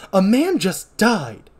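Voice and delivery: slow, high, loud outrage; indignant protest. Acted. Sister Catherine rebukes Doctor Mixer, indignant and loud. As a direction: protest in drawn-out acted shock. Acted protest at villain's callousness.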